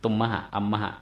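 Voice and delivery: ตุมมหะ อัมมหะ as tum-ma-ha, am-ma-ha; short linking "ma" inside ตุมมหะ อัมมหะ; linked-consonant reading. Shown as a demonstration. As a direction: let ม close the syllable and blend into หะ in ตุมมหะ อัมมหะ. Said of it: "มะตัวนี้เป็นสะกดควบกล้".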